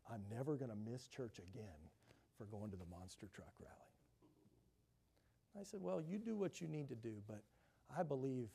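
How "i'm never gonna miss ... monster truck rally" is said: soft, low vow; quiet resolve. Retold or imitated. A father vows to his pastor, softly and low. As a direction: murmur the promise softly.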